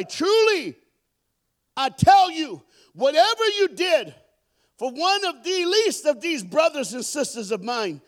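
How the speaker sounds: high, booming proclamation, drawn out; king's proclamation. Acted. Preacher reading the king's reply to the congregation, loud proclamation. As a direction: proclaim it loudly as the king, stretching the words.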